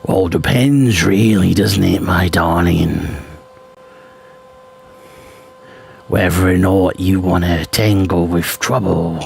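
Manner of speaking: lightly voiced gruff barbarian. Shown as a demonstration. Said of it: "one of my barbarian voices".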